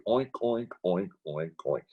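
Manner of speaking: a hint of pig grunting; playful mock oinking; mock pig oinks. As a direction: oink lightly like a hog at the trough. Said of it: "hog theology".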